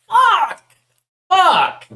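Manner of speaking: frustrated yell. Real, not acted. Streamer's project crashed.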